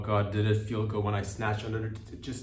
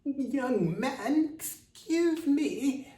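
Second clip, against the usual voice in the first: put-on very high, slow frail old lady voice; 5 dB louder, much slower than usual. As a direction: creak out a tiny old woman's plea. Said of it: "she's like".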